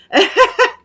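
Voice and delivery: a quick, high burst of laughter; sudden high laugh. Real, not acted. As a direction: laugh out loud at the comment. Reacting to a viewer's comment.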